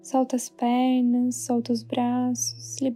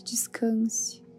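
whisper; 4 semitones higher, 3 dB quieter than usual. Acted. Whispered final word of phrase.

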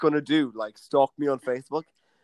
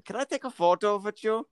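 hint of a German accent; bad German accent; 6 semitones higher, 4 dB quieter than usual. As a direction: vith for with, a light German lilt. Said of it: "I just thought German".

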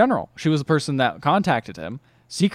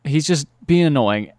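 usual speaking voice

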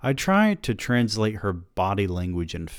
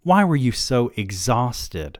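slow, tight, a touch louder; mildly clenched, holding back anger; wife pressing her husband, understated tension; 5 semitones higher, 4 dB louder, slower than usual. A narrator's reading. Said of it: "her voice now clenched".

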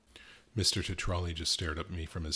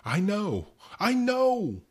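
anguished; 11 dB louder, much slower than usual. Acted. Tetrali breaking down.

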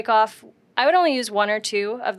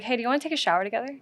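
quick, softer flirty invite; sexier; 2 semitones higher, 5 dB quieter, much faster than usual. Pretend. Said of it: "You can say it sexier if you want".

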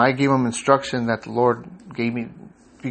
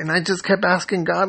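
normal talking voice

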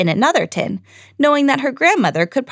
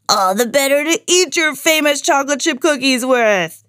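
shouting; 6 semitones higher than usual. A narrator's reading. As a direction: burst out the reveal. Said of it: "he shouted".

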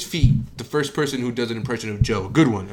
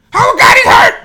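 much higher, louder shouted call; Joe Rogan MMA call; 16 dB louder than usual. Retold or imitated. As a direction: yell like an MMA commentator. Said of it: "I can do M M A Joe Rogan".

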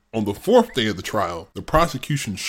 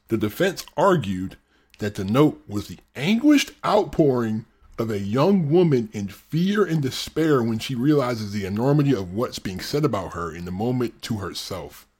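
ordinary talk

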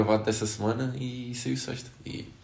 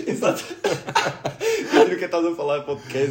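laughing; 7 semitones higher, faster than usual. Real, not acted. Laughing at the image.

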